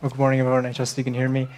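usual speaking voice

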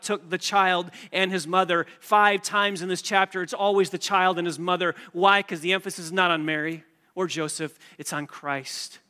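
preaching at full force. Acted. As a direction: preach it to the back row, building. Preacher drives the point home.

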